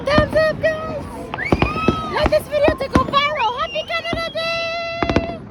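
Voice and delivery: high, loud holiday shout-out; high-pitched celebratory call; excited cheer. Real, not acted. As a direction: cheer it out to the viewers. Cheering to viewers at fireworks.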